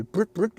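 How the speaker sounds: amateur vocal honk, twice; rough little "toot toot" horn noise; car horn imitation. Shown as a demonstration. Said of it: "je fais pas très bien, mais j'ai pas fait klaxon".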